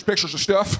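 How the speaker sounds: lightly voiced clueless ex-president; George W. Bush. Former president explaining his pictures, clueless and folksy. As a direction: answer simply, ending on a rise. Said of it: "when they ask him about that".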